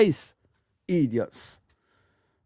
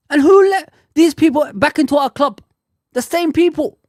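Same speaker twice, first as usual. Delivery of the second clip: angry shout; 7 semitones higher, 7 dB louder than usual. Real, not acted. Ranting fan shouting at listeners.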